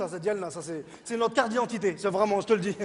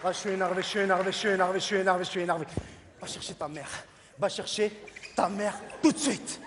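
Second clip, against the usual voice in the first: mild mock anger; 5 semitones lower, 5 dB quieter than usual. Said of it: "ça y est, énervé".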